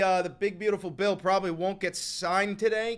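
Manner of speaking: ordinary delivery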